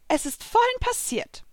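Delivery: high-pitched character voice; high voice of a servant spirit; Virgo. A narrator's reading. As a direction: lift it well above the narrator's voice. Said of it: "erzählte Virgo".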